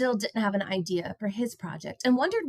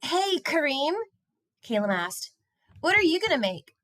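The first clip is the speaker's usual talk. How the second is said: put-on a touch higher, curious boy; gently voiced young boy asking; lightly voiced boy Caleb; 8 semitones higher than usual. A narrator's reading. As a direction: ask it like a curious kid. Boy character voice.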